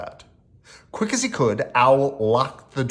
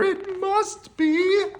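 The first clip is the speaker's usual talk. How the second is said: high, slow, quavering spooky build-up; fearful stage whisper; slower than usual. A narrator's reading. Friends fear the monster is outside, slow spooky dread. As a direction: draw out the last word in mock dread. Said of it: "they all whispered together".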